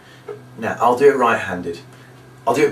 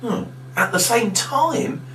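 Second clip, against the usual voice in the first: mock puzzlement; 11 semitones higher, slower than usual. Shown as a demonstration. Feigning not to understand.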